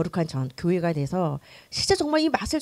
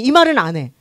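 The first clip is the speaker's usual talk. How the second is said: ordinary delivery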